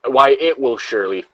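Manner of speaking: ordinary talk, nothing marked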